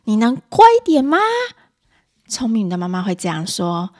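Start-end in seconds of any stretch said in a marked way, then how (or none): 0.1-1.2 loud, high exasperated demand; irritable mother. Acted. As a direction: act a mum snapping at a naughty child. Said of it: "暴躁的妈妈会说". (暴躁妈妈大声不耐烦地质问)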